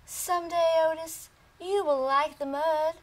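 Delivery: a mama pig gently promising her son. Acted. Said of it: "His mama told him".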